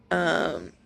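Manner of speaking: ordinary talk, nothing marked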